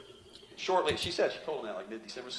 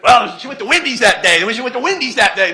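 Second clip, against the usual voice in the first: mocking defense lawyer; 7 semitones higher, 15 dB louder than usual. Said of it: "what he said in opening statement".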